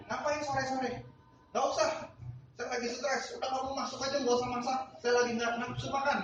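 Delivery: high, mock grumpy brush-off; imitating a stressed husband snapping; irritated husband. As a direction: wave her away impatiently. Stressed husband snaps at wife.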